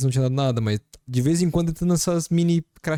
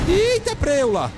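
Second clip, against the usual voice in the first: high startled cry; startled yelp; 3 dB louder, slower than usual. Real, not acted. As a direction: yelp in sudden fright. Jump scare in horror game.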